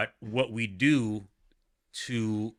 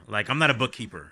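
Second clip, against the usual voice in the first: ordinary talk, nothing marked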